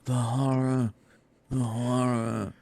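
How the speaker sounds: quiet, slow, breathy Brando whisper; Brando impression. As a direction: murmur it like a dying Colonel Kurtz. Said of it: "I'm imagining Marlon Brando".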